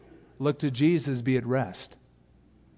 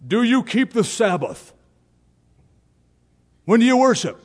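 put-on Southern accent; 8 semitones higher, 4 dB louder than usual. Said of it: "why do I always do a Southern accent?".

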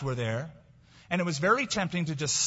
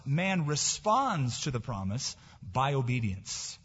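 ordinary delivery

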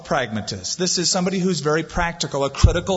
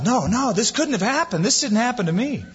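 a hint of pleading denial, pitched up; 8 semitones higher than usual. Grieving person in denial, lightly voiced and pitched up. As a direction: voice the griever refusing the news. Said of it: "The first being denial".

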